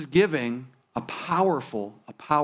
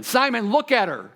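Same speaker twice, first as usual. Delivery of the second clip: higher, emphatic order; mock stern command; 4 semitones higher than usual. Preacher acting Jesus ordering Simon to look, stern command. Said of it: "I don't think he said it like that".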